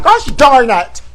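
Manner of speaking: loud, high mock-furious cry; shouting in hammed-up anger; mad outburst. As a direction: blow up in campy outrage. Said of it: "And I'm mad".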